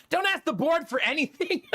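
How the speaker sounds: playful impression of the neighbor's wife; mock warning. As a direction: squeak it like a fussy busybody. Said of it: "always telling me".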